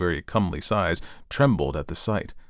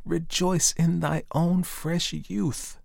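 slow, high, hushed blessing; air and sunlight; 9 semitones higher, much slower than usual. A narrator's reading. Air and sunlight bless the fir, very slow and high. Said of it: "said the air and the sunlight".

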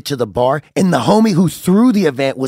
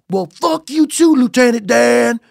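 slow, high-pitched mock outrage; retold hot-headed retort; 10 semitones higher, slower than usual. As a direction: stretch out a heated comeback for laughs. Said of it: "She got me just yelling at me, bro, and I was hot".

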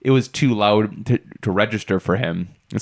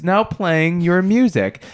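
slow, higher machine announcer; slow assistant voice; 7 semitones higher, slower than usual. Retold or imitated. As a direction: say it like a smart speaker. Alexa voice imitated.

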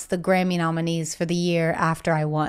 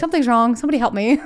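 half-hearted scream, pitched up, laughing at the end; mock call for help; 4 semitones higher, 3 dB louder, faster than usual. Showing the host how she asks for help, mock scream. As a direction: play at alarm, a little raised and high. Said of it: "the biggest fan of screaming for help".

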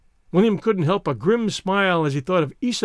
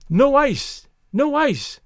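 shouted gaily; 8 semitones higher, much slower than usual. A narrator's reading. Moira jumping up and down in the taxi, shouting gaily. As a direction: call it out with bright glee. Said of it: "she shouted gaily".

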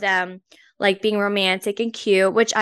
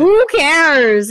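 louder, drawn-out exasperation; exasperated; 5 semitones higher, 7 dB louder than usual. Real, not acted. Co-host dismissing friend's worry about a stranger, exasperated. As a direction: brush off the excuse emphatically.

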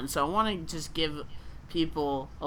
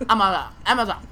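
higher, louder garbled Amazon Amazon; louder mock accent on Amazon Amazon; misheard thick accent; 4 semitones higher, 8 dB louder, faster than usual. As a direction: mangle Amazon Amazon like a heavy accent. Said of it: "but what she heard was".

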